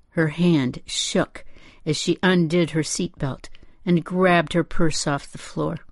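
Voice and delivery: plain reading voice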